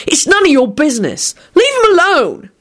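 high, heated warning; indignant threat; threatening. A narrator's reading. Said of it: "he said threateningly".

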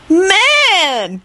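high, drawn-out wail; anguished wail. A narrator's reading. Kenny mourns his friend, wailing. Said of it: "wailed in a genuinely anguished voice".

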